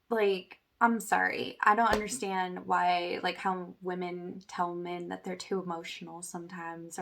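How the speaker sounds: normal talking voice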